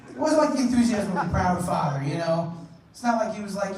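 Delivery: usual speaking voice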